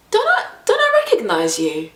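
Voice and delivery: quizzing a passenger he thinks he knows; imitating the driver. Driver voiced in retold story.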